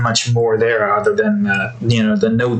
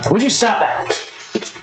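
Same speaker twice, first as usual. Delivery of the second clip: scolding the cat; 8 semitones higher than usual. Real, not acted.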